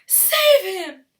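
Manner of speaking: high, loud panicked scream. Acted. Mother screaming as her son falls in. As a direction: scream for help. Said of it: "screamed Missus Gloop".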